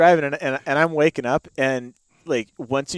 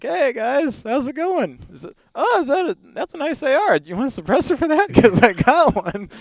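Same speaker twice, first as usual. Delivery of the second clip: mock chirpy friendliness; 12 semitones higher, 3 dB louder than usual. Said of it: "I'm like".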